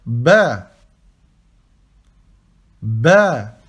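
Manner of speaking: Ba said firmly with a clear B; Arabic letter name. Shown as a demonstration. Teacher modelling Ba for beginners, firmly. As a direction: model Ba loudly for repetition. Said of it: "la deuxième lettre".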